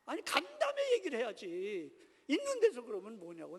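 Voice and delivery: indignant, pitch leaping then falling; angry protest. Retold or imitated. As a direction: reenact an exasperated protest. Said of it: "그러면 화가 나요".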